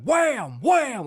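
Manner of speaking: usual speaking voice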